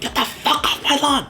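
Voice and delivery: high-pitched mock cranky old-timer, laughing through it; grumpy old person. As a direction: yell it like a cranky old neighbor, half laughing. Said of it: "old people are like".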